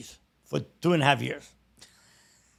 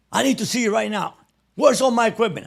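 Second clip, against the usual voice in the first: high, raised, accusing questions; angry demand; 8 semitones higher than usual. Retold or imitated. Sergeant confronting Valdez about missing equipment, angry. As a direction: demand answers from the man you blame.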